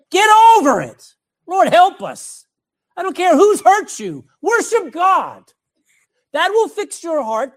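high, loud and hard, driving it home; preaching at full force. Real, not acted. Preacher exhorting the offended congregation, loud fiery preaching. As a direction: shout it at the congregation like a rebuke.

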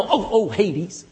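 slow, prim mock cry of pain; lightly hammed-up prim swear after a stubbed toe; mock prissy exclaim. Preacher mocking an enemy stubbing a toe, prissy mock cry. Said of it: "they don't go".

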